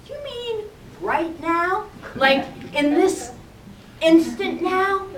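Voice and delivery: high, slow, wondering question; mock astonished realization; dawning surprise. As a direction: realize it slowly, amazed. Realizing what the voice means.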